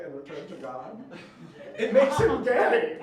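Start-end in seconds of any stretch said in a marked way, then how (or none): 1.8-3.0 loud, high, drawn-out punchline; comic yell, stretched out; exasperated shout. Acted. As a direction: belt out the answer slowly. Exasperated punchline after long rant.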